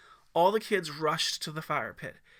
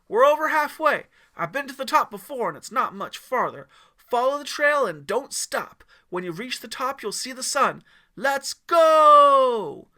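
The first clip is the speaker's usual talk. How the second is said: rallying shout; 9 semitones higher, 14 dB louder than usual. Acted. Leader rallies troop loudly.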